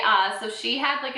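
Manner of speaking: ordinary talk, nothing marked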